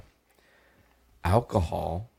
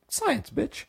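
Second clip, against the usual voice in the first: much higher, cocky mocking voice; mock roommate; 5 dB quieter than usual. Roommates justifying the biscuit plan in a smug high voice. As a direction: sneer it like a smug know-it-all.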